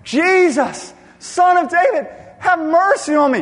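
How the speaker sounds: very high, loud yelled plea; desperate crying out. Acted. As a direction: cry out harder, refusing to be silenced. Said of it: "he cries out".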